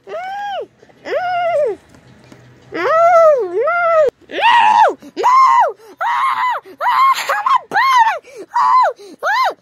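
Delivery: very high, loud panicked shrieking; labor screams. Acted. Patient giving birth screaming to the doctor, high and loud. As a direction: wail in pain as the birth hits.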